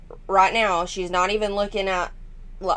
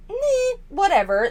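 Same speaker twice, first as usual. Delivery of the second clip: dismissive meh; 11 semitones higher than usual. Retold or imitated. Mimicking their careless attitude.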